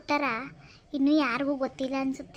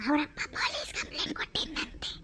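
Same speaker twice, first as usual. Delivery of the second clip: gossip whisper; 7 dB quieter than usual. Acted. Gossiping neighbour whispers about the police complaint. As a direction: whisper the secret.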